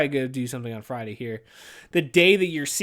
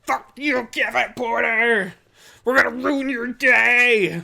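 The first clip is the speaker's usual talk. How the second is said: high, slow, louder taunt; mock taunting voice; 7 semitones higher, 7 dB louder, much slower than usual. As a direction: sneer it out like a villain. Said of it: "Just like".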